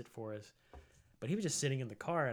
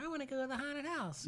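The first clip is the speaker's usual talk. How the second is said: put-on high, pleading kid voice; child begging dad, high and whiny; whiny little kid; 14 semitones higher than usual. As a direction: beg like a seven-year-old. Kid begging dad for haunted house.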